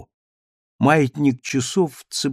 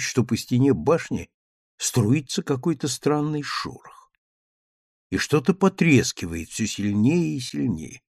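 plain reading voice

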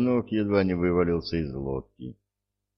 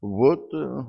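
high, louder tearful sob; sobbing; 8 semitones higher, 4 dB louder than usual. A narrator's reading. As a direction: blurt it through tears. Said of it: "всхлипнул он".

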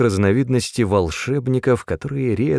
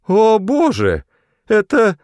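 high, louder cry of horror; alarmed cry; 13 semitones higher, 6 dB louder, slower than usual. A narrator's reading. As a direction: break off aghast at the sight. Sees a monster rushing closer.

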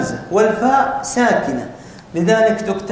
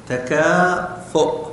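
تكافؤ stretched slowly through a light laugh; 2 semitones lower, much slower than usual. Shown as a demonstration. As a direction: drawl تكافؤ, half laughing. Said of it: "سأرسمها على واو". (تكافؤ ببطء مع ضحكة خفيفة)

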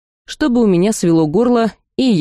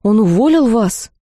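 higher-pitched puzzled questions; surprised; 4 semitones higher than usual. A narrator's reading. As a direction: ask in surprise, pitch lifted. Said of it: "удивилась я".

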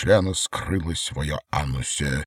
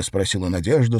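plain reading voice

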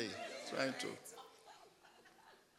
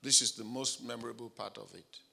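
whispered aside; 8 semitones lower, 6 dB quieter than usual. Real, not acted.

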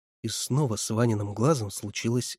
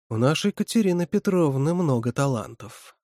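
usual speaking voice

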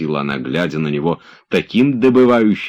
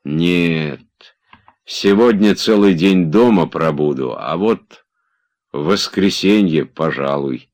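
ordinary delivery

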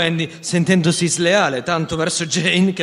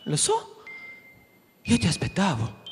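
put-on little girl voice; 3 semitones higher than usual. Jane greets Peter.

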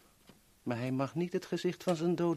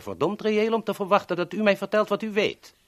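louder and higher, insisting hard; angry demand; 7 semitones higher, 11 dB louder than usual. Acted. Rescuer demanding what the captive knows, loud angry insistence. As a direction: press him angrily for the truth.

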